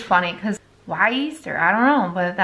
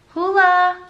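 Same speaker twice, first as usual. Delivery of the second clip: raised, higher-pitched call to a dog; warning call; 6 semitones higher, 4 dB louder than usual. Real, not acted. Owner checks her dog mid-makeup, raised warning call. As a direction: call the dog's name sharply to stop it.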